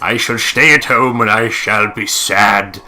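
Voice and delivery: Winston Churchill. Pretend. Mocking the heroine's choice in a pompous, slow Churchill voice. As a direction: declaim it like a wartime prime minister. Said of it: "turned into Winston Churchill there".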